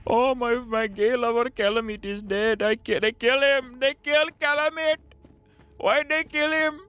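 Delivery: very high, slow, wailing mock lament; mock grieving boss. Streamer voicing the enraged boss over Calamite, very high mock wail. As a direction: ham up a heartbroken villain's whine.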